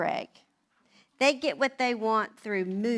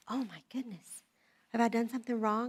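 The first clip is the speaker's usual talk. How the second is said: small, hushed, anxious voice; worried; 6 dB quieter, faster than usual. Retold or imitated. New wife fearing she did wrong, hushed worry. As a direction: ask very softly, as if dreading the answer.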